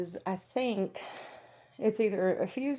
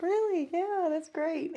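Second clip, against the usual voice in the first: surprised; 9 semitones higher, 4 dB louder, slower than usual. Retold or imitated. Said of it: "I was like".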